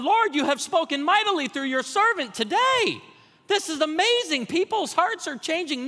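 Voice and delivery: high, loud, exultant altar prayer, hammed up; imitation of a triumphant revival preacher; mock fervent preaching. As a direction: cry out to the Lord in exaggerated rapture. Mimics his own fervent altar prayer.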